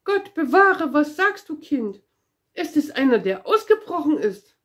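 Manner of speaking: high, loud startled cry; frightened shriek. A narrator's reading. Lady at the window, startled by Pippi, shrieks in fright. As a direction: shriek in shock at the head in the window. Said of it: "fingen vor Schreck an zu schreien".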